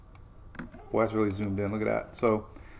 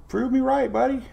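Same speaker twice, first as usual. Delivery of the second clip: much higher, excited plea to the puppy; excited gasp; 11 semitones higher than usual. Real, not acted. Waiting for the puppy to yawn.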